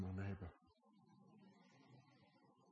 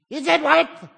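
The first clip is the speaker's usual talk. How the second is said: shouted question; 24 dB louder than usual. Real, not acted. Shouts to congregation.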